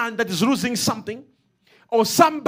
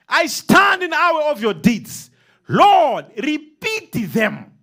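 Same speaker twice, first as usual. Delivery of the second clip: fervent cry; 5 semitones higher, 5 dB louder, slower than usual. Acted. Preacher crying out a prayer.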